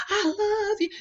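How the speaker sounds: high put-on voice saying I love you; mock sweet lover. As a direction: mimic a sugary romantic line. Mocking a man's sweet words.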